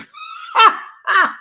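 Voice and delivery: high shouted laugh; shrieking laugh. Real, not acted. Laugh alone.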